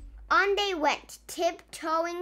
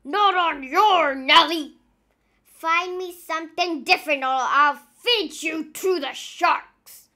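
a much louder captain's roar; 2 semitones higher, 9 dB louder than usual. A narrator's reading. Captain Pepper threatening to feed the crew to sharks, roaring. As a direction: threaten the crew at full volume. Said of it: "he roared".

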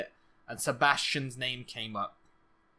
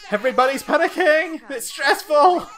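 very high, very loud, comic hysteria; panicked shouting; 11 semitones higher, 11 dB louder than usual. Pretend. Gamer shouting that everybody's panicking, comic hysteria. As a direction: yell it at the peak of the chaos. Chaos in the game peaks.

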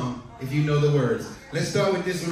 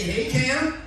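pitched-up cue for the crowd to echo; call and response; 8 semitones higher than usual. Shown as a demonstration. Cruise director modeling the crowd's reply, pitched up. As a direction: lead the room in a greeting. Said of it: "Everybody say".

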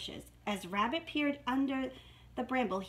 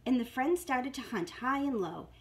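usual speaking voice

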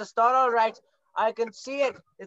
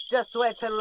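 normal talking voice

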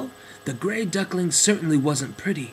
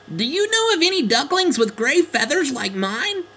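little duckling voice; 12 semitones higher, 4 dB louder than usual. Acted. Duckling asks other birds.

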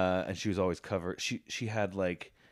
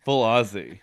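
laughing; 7 dB louder than usual. Real, not acted. Repeating joke while laughing.

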